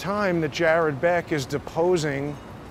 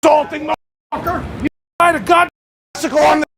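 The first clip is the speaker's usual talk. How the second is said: screaming much higher and louder in real rage; furious shout; 10 semitones higher, 9 dB louder, faster than usual. Real, not acted. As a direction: yell him off the sidewalk at full force. Real street fight with cyclist.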